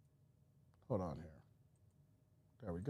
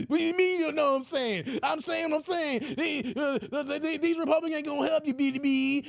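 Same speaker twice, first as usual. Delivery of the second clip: high squeaky shouting rant; Roland Martin impression; 8 semitones higher than usual. Roland insisting Republicans won't help, high shout. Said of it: "Roland, this ain't it".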